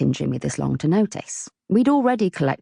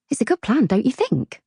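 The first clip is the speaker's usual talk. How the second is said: bright, much higher, wheedling; coaxing; 8 semitones higher than usual. Acted. Loretta coaxing Jimmy to accept her plan, wheedling. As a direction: sweet-talk him into agreeing. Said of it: "I coaxed".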